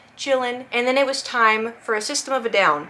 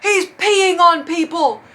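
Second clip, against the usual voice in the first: high, slow, drawn-out yell; hammed-up indignant shout; outraged concertgoer; 9 semitones higher, 5 dB louder, slower than usual. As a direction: imitate a man reporting a pervert to security. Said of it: "this guy goes".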